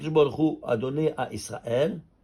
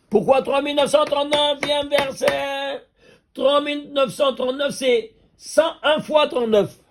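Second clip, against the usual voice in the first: much higher, laughing as the answer lands; thrilled revelation; 10 semitones higher, 3 dB louder than usual. Real, not acted. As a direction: build to a gleeful punchline. Thrilled at numerical proof.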